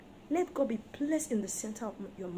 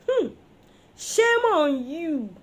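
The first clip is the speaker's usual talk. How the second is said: loud, very slow rebuke; drawn-out scolding; 4 semitones higher, 8 dB louder, slower than usual. Real, not acted. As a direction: stretch out the shame. Preacher scolding the wives.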